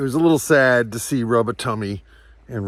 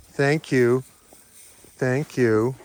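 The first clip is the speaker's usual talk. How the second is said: usual speaking voice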